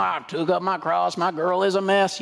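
mock country singer's twang; country western twang. Preacher parodying a country western song, mock twang. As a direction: put on a hammy country western accent. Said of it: "We hear in a country western song".

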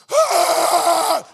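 loud roaring yell; loud furious war cry; battle yell. Retold or imitated. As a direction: charge in with a roar. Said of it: "I was like".

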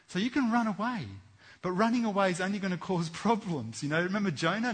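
loud, high preaching to the congregation; preaching at full force. Acted. Preacher warning the congregation, at full force. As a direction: drive the warning home from the pulpit.

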